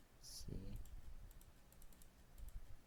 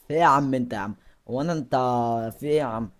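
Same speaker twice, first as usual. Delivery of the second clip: annoyed yell; 3 semitones higher, 12 dB louder, faster than usual. Real, not acted. Yelling at the game character.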